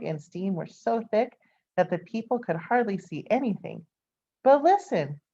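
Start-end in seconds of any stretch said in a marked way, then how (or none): 4.4-5.2 excited. A narrator's reading. Building the dig climax.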